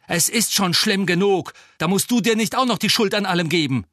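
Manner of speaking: high, loud exasperated tirade; angry outburst. A narrator's reading. Harry tells Ron to stop blaming himself, exploding. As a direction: let it burst out, speeding up. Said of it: "platzte Harry heraus".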